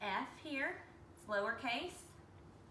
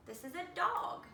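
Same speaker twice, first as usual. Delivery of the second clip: ordinary talk, nothing marked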